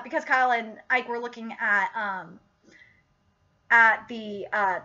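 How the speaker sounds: ordinary talk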